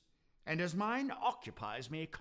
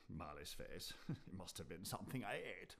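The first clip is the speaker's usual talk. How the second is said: nervous murmur; 10 semitones lower, 12 dB quieter than usual. Acted. Scrooge alone in his room, much softer and lower, nervously.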